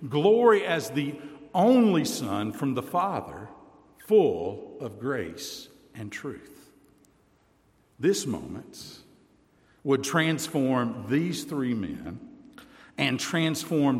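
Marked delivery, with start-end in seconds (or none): none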